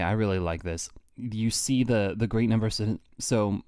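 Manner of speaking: ordinary talk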